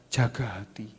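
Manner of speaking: soft breathy whisper; guard your heart, whispered. Real, not acted. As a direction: lean in and whisper it. Whispered key point.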